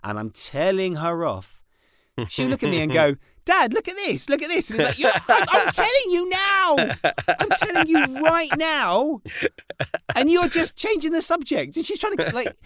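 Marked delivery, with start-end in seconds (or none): 3.5-4.7 put-on high, eager little girl voice; bright high kid calling dad; imitating daughter. Daughter voiced interrupting telling off. 5.0-11.8 slow, high mock-stern telling off; exasperated parent, slow and high; slow and intense. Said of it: "speaking really slowly and really intensely".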